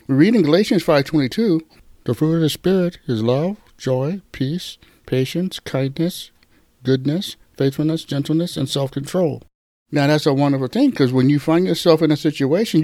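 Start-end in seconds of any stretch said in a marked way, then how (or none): 2.0-9.4 softer, measured reading of a verse list; scripture reading. Acted. Preacher reading Galatians to the congregation, softly. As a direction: read each virtue gently like a list of gifts.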